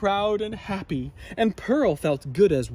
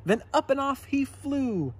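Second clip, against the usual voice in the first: usual speaking voice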